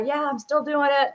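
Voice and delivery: still pumped. Acted. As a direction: keep up the gung-ho act. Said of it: "get so excited about it".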